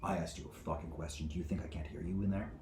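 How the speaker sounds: quick, low, quiet demand; controlled threat. Acted. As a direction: keep the anger reined in and quick. Judge repeats question, first controlled.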